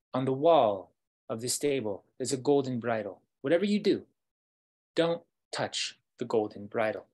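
loud instruction, rising to a high peak; emphatic warning. Acted. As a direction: drive the warning home forcefully. Wolf warns the prince.

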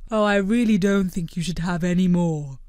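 gentle, measured cartoon creature; Blobbert voice. A narrator's reading.

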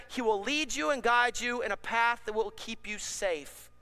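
usual speaking voice

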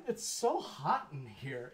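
ordinary delivery

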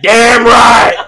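high, loud excited yell; the cook yells his excitement; retold cook's shout. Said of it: "He said".

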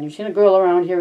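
Curly barking the question mildly; angry demand. A narrator's reading. Curly demanding of George where the girl is, mildly angry. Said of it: "he demanded angrily".

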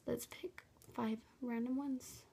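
slow nervous whisper. Real, not acted. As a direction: breathe it out slowly, nervous. Whispering before the challenge.

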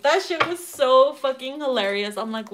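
ordinary delivery

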